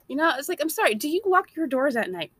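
usual speaking voice

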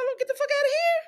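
high, sharp mock outburst; exasperated yell. Telling ex-husband to just take the dead fish out, high mock yell. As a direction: snap it at him, fed up. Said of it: "I said".

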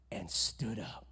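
hushed reverent reading; dramatic whisper. Real, not acted. Pastor reading the verse, whispered awe. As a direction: whisper the climax.

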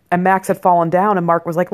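ordinary talk

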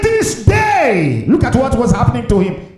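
high, loud preacher's cry; full-force preaching. Real, not acted. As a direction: preach it out at the top of your range.

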